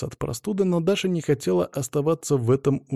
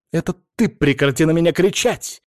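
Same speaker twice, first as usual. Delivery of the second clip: loud, raised-pitch comeback; angry retort; 6 semitones higher, 5 dB louder than usual. A narrator's reading. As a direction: fire back in a heated quarrel. Wife shouts back in quarrel. (сердито, на повышенных тонах)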